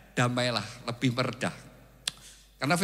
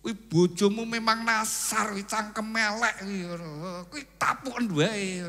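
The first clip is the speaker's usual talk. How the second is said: devil's voice; 7 semitones higher, 4 dB louder than usual. Acted. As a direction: egg him on like the devil. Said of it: "Tapi iblis".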